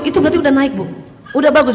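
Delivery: normal talking voice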